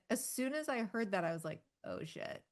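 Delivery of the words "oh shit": a sudden breathless whisper of realization; whispered oh shit. Retold or imitated. Retelling her realization about carts, hushed whisper. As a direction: whisper it like you just caught your own mistake.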